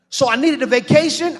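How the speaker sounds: loud, high preaching climax; full-force preaching. Real, not acted. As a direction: pour it out to the congregation, loud and high.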